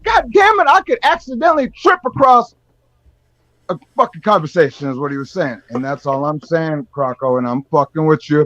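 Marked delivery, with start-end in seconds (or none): 0.0-4.9 high, exasperated mock rant; mock Tinfoil. Imitating Tinfoil griping at Croco, high and exasperated. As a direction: play the fed-up buddy griping, pitched up. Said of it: "Tinfoil's looking at you like".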